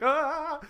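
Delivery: high, loud mock scream; loud comic imitated shriek; retold yelp. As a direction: scream like the startled guy in the retelling. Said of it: "and he's like".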